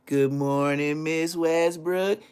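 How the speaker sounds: slow singsong, WESTBROOK stretched upward; drawn-out greeting. Retold or imitated. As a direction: imitate a pupil chanting a greeting. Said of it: "Don't walk in that class and say".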